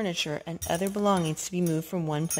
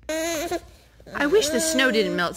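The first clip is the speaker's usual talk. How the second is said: Skunk voice; 9 semitones higher, 3 dB louder, slower than usual. Acted.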